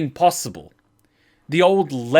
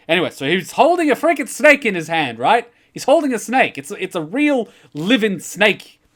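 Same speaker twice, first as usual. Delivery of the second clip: higher and louder, wound up; telling friends about the snake, higher and louder in excitement; excited retelling; 7 semitones higher, 5 dB louder than usual. Retold or imitated. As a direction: let the disbelief run away with you.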